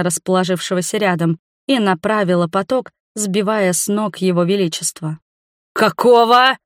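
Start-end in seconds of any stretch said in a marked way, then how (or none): 5.7-6.6 loud, high outraged cry; furious raised-voice exclamation; roaring. A narrator's reading. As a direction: roar it in outrage. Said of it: "взревел он".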